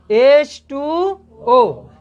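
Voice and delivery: each letter stretched very slowly; drawn-out answer. Shown as a demonstration. Teacher giving the class the water formula, very slow. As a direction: drag out the answer so the class can chant along.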